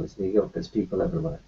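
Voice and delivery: usual speaking voice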